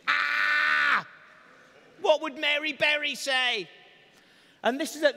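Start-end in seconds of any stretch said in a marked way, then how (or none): 0.1-1.1 a high, loud, hammed-up "Ah!"; sudden mock shriek; mock panicked gasp. Panicking over disappointing cake. 2.0-3.7 mock panic. Panicking over disappointing cake.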